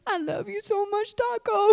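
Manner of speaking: soft, high pet-talk voice; small, high, gushy voice; doting on dog. Retold or imitated. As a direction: coo it lovingly to your dog. Making up with the dog.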